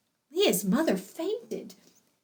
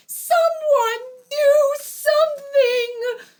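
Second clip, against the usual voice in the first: high, loud, drawn-out wail; mother's desperate cry; 7 dB louder, slower than usual. A narrator's reading. Bride's mother begs the guests for help, crying out. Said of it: "cried Leah's mother".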